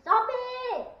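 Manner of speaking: high, loud alarm call; excited shout. Real, not acted. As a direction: yell and charge in. Rushing to fight zombie.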